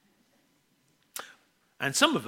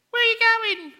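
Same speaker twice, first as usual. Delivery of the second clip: put-on much higher, piping little boy asking; small child's voice; 7 dB louder than usual. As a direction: squeak it like a five-year-old. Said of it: "she would hear this voice".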